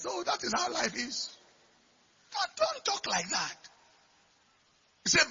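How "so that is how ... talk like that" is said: high, soft voice; high-pitched, quiet aside; mock careless remark. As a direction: pitch it up and keep it light. Voicing careless talk, then hushed reproof.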